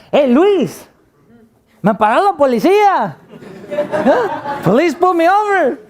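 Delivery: excited phone call. Retold or imitated. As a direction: blurt the news up high, half laughing.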